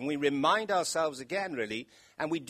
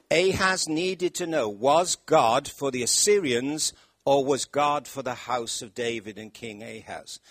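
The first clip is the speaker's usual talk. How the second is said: normal talking voice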